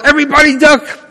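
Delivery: high, stretched-up pretend shout, little added volume; mock warning yell. Preacher imagines the congregation seeing Jesus, pretend yell. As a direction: call it out high like a sudden warning. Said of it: "we'd all have to yell".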